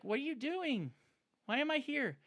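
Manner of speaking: high, put-upon pet voice; voicing the dragon. Owner voicing her grumpy dragon's thoughts, high and put-upon. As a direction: squeak out the grumpy dragon's complaint. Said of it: "He's like".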